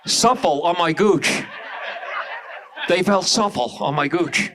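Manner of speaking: slushy-s celebrity imitation; Caitlyn Jenner. Caitlyn Jenner describing women's panties, slushy-s impression. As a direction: keep up the slurred sibilant impression. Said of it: "you ever hear Caitlyn Jenner talk?".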